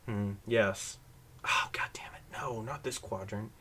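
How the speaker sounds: low, soft mutter with a whispered curse; muttering to herself. Shown as a demonstration. Host demoing a spy covertly talking into a mic, hushed mutter. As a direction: mumble under your breath as if alone. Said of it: "So you do this".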